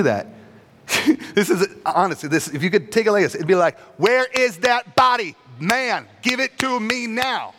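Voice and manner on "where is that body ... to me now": high, shouted demand, then slowed down; hammed-up pushy demand for the body; aggressive demand. Mary confronting the gardener, with a mock-aggressive shout. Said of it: "Like Elizabeth Warren on it".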